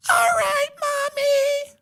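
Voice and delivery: put-on tiny girl bravely agreeing; little girl voice. Daughter answers mother.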